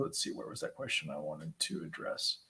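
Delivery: low, very soft thinking aloud; muttering to self. Real, not acted. Host searching for the next question, muttering to self.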